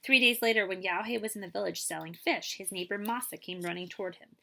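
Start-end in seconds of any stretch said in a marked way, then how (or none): none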